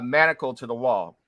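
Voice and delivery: ordinary talk, nothing marked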